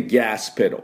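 「ガスペダル」を「ギャースペロー」と発音; 「ガス」は「ギャース」、「ペダル」は「ペロー」; アメリカ発音. Shown as a demonstration. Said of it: "っていうふうに発音します". (手本として「ガスペダル」を米国式に「ギャースペロー」)

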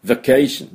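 vacation with a quick light VA and high CAY; brief first syllable. Shown as a demonstration. Teacher showing the first vowel is just brief, light VA. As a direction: flick past VA in vacation, land on CAY. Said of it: "ちょっと言うだけ".

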